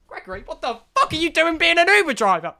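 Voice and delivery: loud, high, disbelieving outburst; mock-outraged shout; incredulous yell. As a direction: blurt it out in shock.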